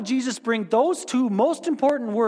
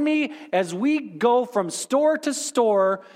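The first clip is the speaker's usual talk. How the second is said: sarcastic zeal; 3 semitones higher than usual. Pretend. Mock crusade against money.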